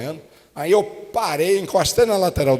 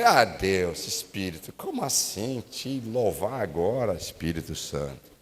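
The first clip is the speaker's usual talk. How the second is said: resmungo baixo e abatido, bem mais grave; reclamando chateado; 8 semitones lower, 7 dB quieter than usual. Retold or imitated. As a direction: reclame baixinho, desanimado com Deus. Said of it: "tô aqui murmurando, reclamando". (murmúrio grave e chateado de quem não quer louvar)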